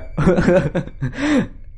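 high laugh breaking out of a fake-serious act; bursting laugh. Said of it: "Cả đám vừa cười".

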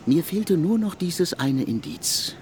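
normal talking voice

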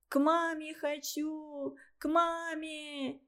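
put-on slow, high tearful child; crying child. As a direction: beg for mum through tears. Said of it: "плачет она".

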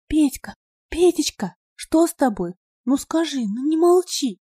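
high, anxious pleading; begging him to answer; pleading girl. A narrator's reading. Надя pleads with sobbing brother, anxious high voice.

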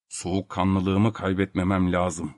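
calm himself down, slowly; thinking aloud. Acted. Filip Filipovich telling himself to stay calm, soft and slow. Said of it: "diye düşündü".

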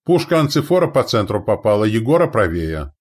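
loud, high spotter's report; shouted report. A narrator's reading. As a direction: call out each gun's result. Observer shouts where shots hit.

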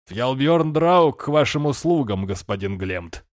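slow, amused mock bow; mock courtly. A narrator's reading. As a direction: play the grand courtier for a laugh. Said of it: "Неуместный пафос развеселил его".

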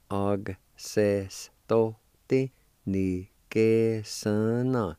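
slow, syllable by syllable; slow demo. Shown as a demonstration. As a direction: og se sto ti, ni que son no, drawn out evenly.

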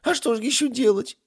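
high, loud, whimpering protest; sobbed question. Acted. Ifrit justifies praying to gods, sobbing. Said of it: "всхлипнул Кебаб".

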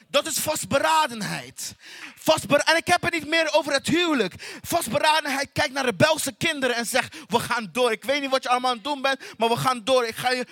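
rally the congregation, full force; rousing preaching. Acted. Preacher rallies the church through hardship, rousing.

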